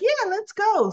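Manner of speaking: high, upbeat mock agreement; eager agreement. Everyone agreeing to go celebrate, eager. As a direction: act out a keen yes.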